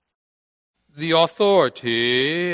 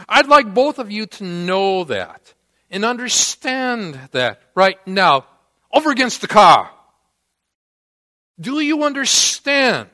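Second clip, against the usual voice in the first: commanding police officer; 2 semitones higher, 3 dB louder than usual. Retold or imitated. Officer ordering drunk guys against the car, commanding. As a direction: lay down the law as a mock police command.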